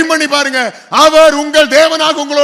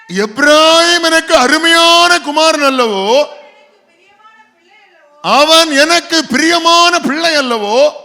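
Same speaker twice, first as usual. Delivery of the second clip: slow, fond voice of a loving father; God fondly calling Ephraim his dear son; tender reading; 4 semitones higher, 4 dB louder, slower than usual. Acted. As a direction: linger on each word with affection. Reading God's tender words.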